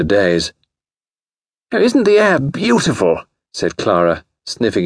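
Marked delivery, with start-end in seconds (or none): 1.7-3.2 high-pitched gushing delight; high, airy society lady; gushing young lady. A narrator's reading. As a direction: gush about it in a high girlish voice. Clara gushing at seaside.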